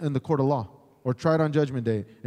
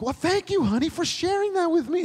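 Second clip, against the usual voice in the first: much higher, sweet mock wife voice; preacher mimics wife thanking husband for sharing, high and sweet; grateful wife. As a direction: coo it like a pleased wife. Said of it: "And she's like".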